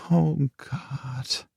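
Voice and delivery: soft despairing whisper. A narrator's reading. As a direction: breathe it out in despair. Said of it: "she whispered".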